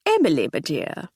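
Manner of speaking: jovial. Acted. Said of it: "said Sir Hector jovially".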